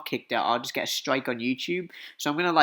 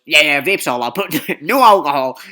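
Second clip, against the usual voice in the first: loud reply breaking into a laugh; imitated loud instructor's ruling; instructor calling back; 10 dB louder than usual. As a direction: shout the answer across a bus. Instructor answers across the bus.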